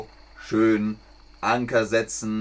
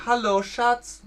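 sweet greeting; 10 semitones higher than usual. Shown as a demonstration. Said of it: "dann könnt ihr sagen".

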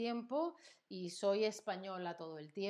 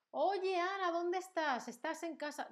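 friend calling; 9 semitones higher than usual. Acted. Acted friend phoning Ana.

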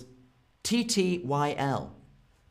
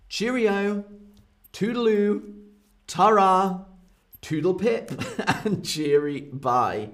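higher, sing-song jolly farewells; silly expression; 6 semitones higher than usual. Shown as a demonstration. Teacher hams up silly British goodbyes, high and jolly. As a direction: chirp them out like a merry old Brit. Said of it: "Such a silly expression".

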